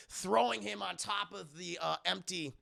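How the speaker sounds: usual speaking voice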